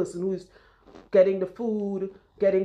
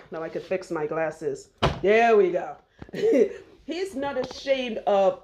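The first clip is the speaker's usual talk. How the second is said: ordinary talk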